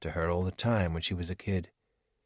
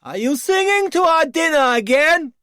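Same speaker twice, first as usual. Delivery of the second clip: much higher, much louder other villager; scolding shout; 10 dB louder than usual. Acted. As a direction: bellow a telling-off across the camp. Voice yelling from hut.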